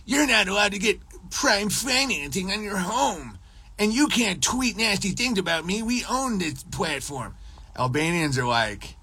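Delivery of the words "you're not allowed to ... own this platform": high-pitched mocking mafia caricature. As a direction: play a put-on mafia threat, pitched high.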